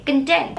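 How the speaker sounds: कंटेंट as kun-TENT; content meaning satisfied. Shown as a demonstration. Teacher repeats the word for satisfied, कंटेंट as kun-TENT. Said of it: "इस word को कंटेंट pronounce करेंगे".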